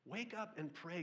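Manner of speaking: high, pressing wake up and pray; urgent wake-up call. Pretend. Captain shaking Jonah awake, high and urgent. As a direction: shake him awake with your voice. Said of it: "captain who's shaking him, saying".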